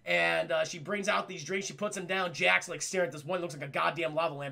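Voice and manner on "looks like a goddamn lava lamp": plain reading voice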